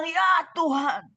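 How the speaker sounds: slow, drawn-out calling on God; retold plea. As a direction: call it out imploringly, stretching the words. Retold widow still pleading to Jesus.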